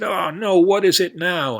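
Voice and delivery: cowboy fed up with his horse, high and loud; exasperated cowboy. Acted. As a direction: throw up your hands like a cowboy.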